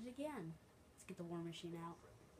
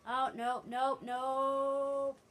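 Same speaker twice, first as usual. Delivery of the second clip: loud, higher-pitched "no" with the last one held long; alarmed shout; 7 semitones higher, 14 dB louder, much slower than usual. Real, not acted. Player reacting to trouble in the game, alarmed shout. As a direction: cry out in dismay, stretching the final word.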